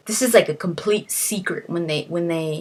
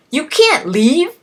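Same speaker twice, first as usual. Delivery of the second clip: higher and louder, a pleading cry; husband's raised protest at his wife; alarmed shout; 8 semitones higher, 7 dB louder than usual. Retold or imitated. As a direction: stop her from walking out. Hoffman protesting her leaving.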